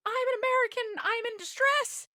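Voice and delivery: loud, very high hammed-up cry; dramatic distress. Traveler phoning front desk, melodramatic high wail. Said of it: "this is just so dramatic".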